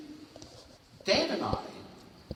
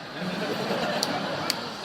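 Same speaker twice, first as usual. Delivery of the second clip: laugh; 3 semitones higher, 4 dB louder than usual. Real, not acted. Laughing at father's line.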